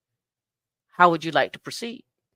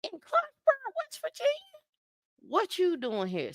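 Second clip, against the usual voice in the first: much higher, startled mock voice; a hammed-up double take; surprised brother; 5 dB quieter than usual. A brother spotting her by chance in Clarksburg, mock startled. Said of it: "he's like".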